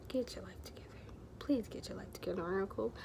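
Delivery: hushed, pleading whisper to herself; soft tearful whisper to self; self-pep whisper. Retold or imitated. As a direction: whisper it like begging yourself to pull it together. Crying alone in bathroom stall.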